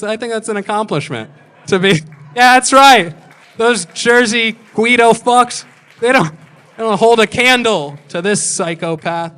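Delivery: loud, high boast about being a psychopath; mock-proud yell; triumphant shout. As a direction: crow it out like a proud maniac. Boasting loudly about being crazy.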